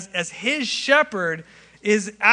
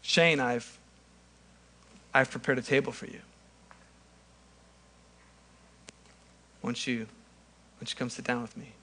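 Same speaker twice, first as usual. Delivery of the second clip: softer, gentle invitation to sit; hushed, tender welcome to the table; tender Jesus voice; 5 semitones lower, 6 dB quieter than usual. Acted. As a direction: speak as Jesus, quietly inviting a friend. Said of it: "so it goes something like this".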